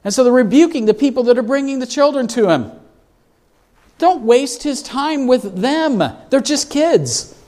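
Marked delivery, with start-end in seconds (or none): 4.0-7.3 dismissive disciples. Retold or imitated. Disciples rebuking the people.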